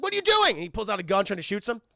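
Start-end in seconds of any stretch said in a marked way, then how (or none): 0.0-0.5 high, loud furious demand; mock angry demand. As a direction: shout at the masked intruder. Harry confronting Spider-Man.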